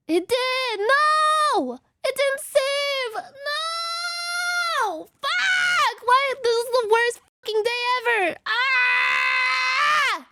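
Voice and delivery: high-pitched screaming meltdown; put-on tantrum, drawn-out high cries; mock rage. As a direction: wail and scream like the worst day ever. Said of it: "I'm sorry, I'm not actually mad".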